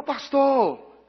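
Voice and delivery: long, high, loud call across the station; drawn-out shout to the pastor. Retold or imitated. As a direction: stretch it out, hailing someone far off. Someone calling out to pastor.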